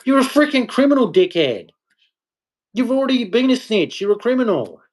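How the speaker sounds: sudden high, fast, heated yelling; angry outburst. Real, not acted. Ranting at criminals who say don't snitch, furious and high. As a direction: lash out in real exasperation.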